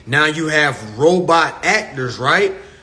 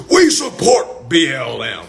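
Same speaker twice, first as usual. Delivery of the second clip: mocking corporate voice; 4 semitones lower than usual. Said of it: "You had corporations".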